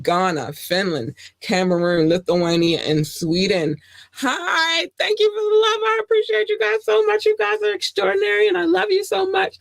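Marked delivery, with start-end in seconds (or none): 4.1-9.6 put-on very high, cutesy little-girl voice; squeaky girlish thanks to the fans; little cartoon girl. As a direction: gush like a tiny cartoon girl. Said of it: "I know I sound like the little girl".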